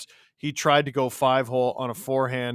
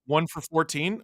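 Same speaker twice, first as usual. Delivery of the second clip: usual speaking voice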